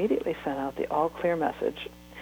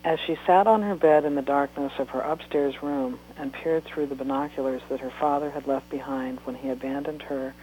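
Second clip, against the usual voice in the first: plain reading voice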